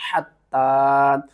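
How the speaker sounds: हत्ता with breathy throat h, hard double t, long aa; slow, stretched हत्ता with a strong t; firm doubled t. Shown as a demonstration. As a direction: press the t in हत्ता and hold the final vowel. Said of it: "ता के ऊपर सख्ती करनी है".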